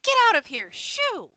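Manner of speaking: high, piercing shooing cry; shrieking. Acted. Someone shooing a boar away, shrieking. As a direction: shriek it to chase an animal off. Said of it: "shrieks and goes".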